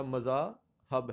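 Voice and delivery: مذاہب slowly as "ma-zaa ... hab"; pronunciation option. Shown as a demonstration. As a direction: say مذاہب split up, ending in "hab". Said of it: "درست لفظ کو کیا ہوگا".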